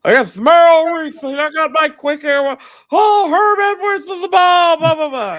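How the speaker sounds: high, loud lisping play-by-play parody; hammed-up announcer yell with a big lisp; Merrill Reese. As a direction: call it like an over-excited lispy broadcaster. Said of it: "big old lisp and everything".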